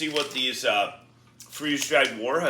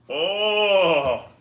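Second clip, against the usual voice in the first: sour groan; 8 semitones higher, 8 dB louder than usual. Real, not acted. Reacting to intense sour candy.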